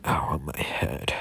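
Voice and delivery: groggy and hurting, barely voiced; pained whisper. Deku wakes up with his head hurting, whispering.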